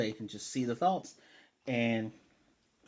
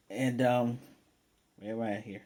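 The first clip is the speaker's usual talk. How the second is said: ordinary talk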